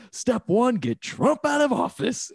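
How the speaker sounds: mock rallying cry. Mocking people's plan to remove Trump, loud rallying cry. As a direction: shout it like a protest chant. Mocking what everyone was saying.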